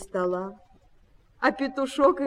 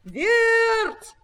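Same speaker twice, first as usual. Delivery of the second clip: long high loud call; 10 semitones higher, 10 dB louder than usual. A narrator's reading. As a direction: call the mice over, drawing out each name. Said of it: "позвал петушок".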